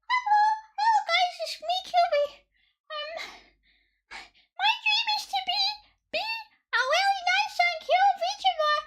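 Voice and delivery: hammed-up kawaii debut voice, breaking into laughs; fake cute VTuber voice. Shown as a demonstration. Streamer mocking a fake-voiced VTuber debut, in a squeaky cute voice. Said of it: "naturally I sound like".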